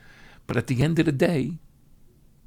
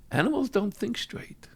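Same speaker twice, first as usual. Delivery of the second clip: hushed whisper; quiet emphatic whisper; 6 semitones higher, 6 dB quieter than usual. Real, not acted. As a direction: lean in and whisper it. Whispered point in sermon.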